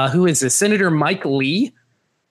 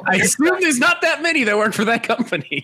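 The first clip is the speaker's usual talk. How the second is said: high, laughing through the words; high-pitched laughing defence; 11 semitones higher than usual. Real, not acted. Laughing over the red-haired woman search.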